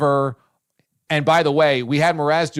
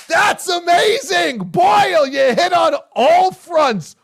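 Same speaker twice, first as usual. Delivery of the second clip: loud, much higher cheer; excited praise; 5 dB louder, slower than usual. Real, not acted. Co-host hailing the host's script, real excited praise. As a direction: cheer him on at full volume.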